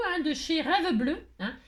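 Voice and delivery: plain reading voice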